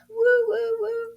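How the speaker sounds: quick high hoots; excited woo. Shown as a demonstration. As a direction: whoop it up high and fast. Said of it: "Sixteenth notes equal faster syllables".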